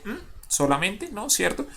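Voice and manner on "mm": short closed-lip "mm"; brief isolated hummed consonant; lone consonant hum. Shown as a demonstration. Teacher showing nobody speaks in lone consonants, short hummed "mm". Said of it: "cuando usted habla dice".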